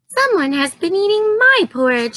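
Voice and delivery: put-on Baby Bear upset about his porridge. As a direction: squeak it like a small cub. Baby Bear voiced in story.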